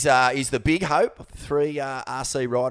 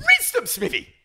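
excited; 11 semitones higher than usual. Acted. Emphatic repeat of boast.

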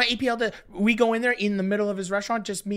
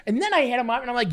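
usual speaking voice

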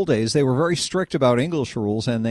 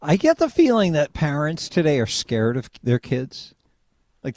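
ordinary delivery

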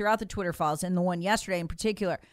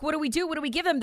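mock panic; 6 semitones higher, much faster than usual. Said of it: "they're even panicked".

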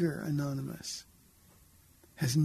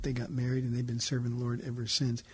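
normal talking voice

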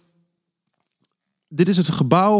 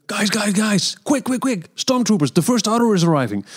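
high, hurried alarm; urgent warning; 8 semitones higher than usual. Retold or imitated. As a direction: warn them the troopers are coming. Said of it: "dan komt er iemand naar hen toe rennen".

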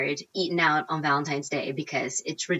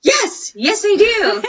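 much higher, louder, laughing squeal of yes; giddy yes; 6 dB louder, slower than usual. Retold or imitated. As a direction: burst out with thrilled disbelief. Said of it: "And I'm like".

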